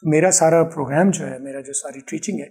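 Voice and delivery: ordinary talk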